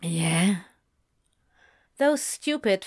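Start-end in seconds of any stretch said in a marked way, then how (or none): 0.0-0.6 whispering. A narrator's reading. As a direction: whisper it hopefully. Hopeful question in the story.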